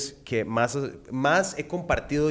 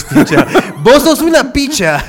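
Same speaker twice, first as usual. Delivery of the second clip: high, loud, laughing shout; laughing exclamation; 11 semitones higher, 11 dB louder than usual. Real, not acted. Host mocks the guest's band name, laughing loudly. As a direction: yell the joke through laughter.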